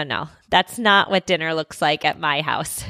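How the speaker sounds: ordinary talk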